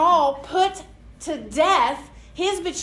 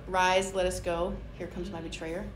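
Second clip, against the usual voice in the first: calm Jesus quote; 7 semitones lower, 7 dB quieter than usual. Retold or imitated. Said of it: "where Jesus did".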